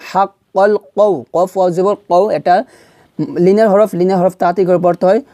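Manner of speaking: ordinary talk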